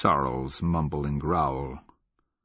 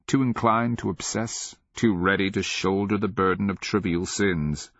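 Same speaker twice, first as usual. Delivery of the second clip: ordinary delivery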